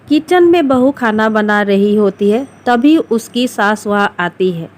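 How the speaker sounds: ordinary delivery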